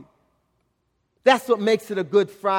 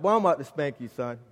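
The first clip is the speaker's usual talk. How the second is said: lowered, softening threat kept private; thinking to himself; 5 semitones lower, 6 dB quieter, faster than usual. Retold or imitated. Father walking son to car, thinking to himself. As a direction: say it under your breath, voice dropping. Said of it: "I'm thinking that to myself".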